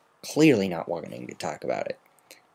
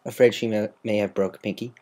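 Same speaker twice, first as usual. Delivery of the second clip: usual speaking voice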